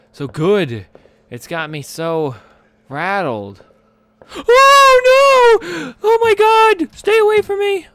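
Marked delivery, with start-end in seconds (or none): 4.3-7.9 high panicked screams, full volume; shrieking in genuine fright; terrified screaming. Real, not acted. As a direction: scream in real terror at the monster. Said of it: "I screamed like a girl in this one".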